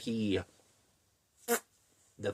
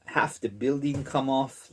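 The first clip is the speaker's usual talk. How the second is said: ordinary delivery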